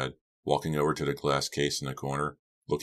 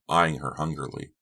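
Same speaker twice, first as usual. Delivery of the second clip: ordinary delivery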